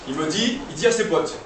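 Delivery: usual speaking voice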